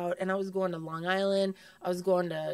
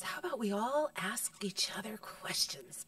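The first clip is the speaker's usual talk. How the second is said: whispered; 8 dB quieter than usual. Real, not acted. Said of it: "unvoiced whisper".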